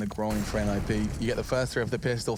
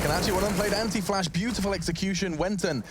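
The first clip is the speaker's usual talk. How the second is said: higher, quickening play-by-play; excited call; 7 semitones higher than usual. Real, not acted. Caster praising the beautiful execution live, excited. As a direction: lift into admiring excitement.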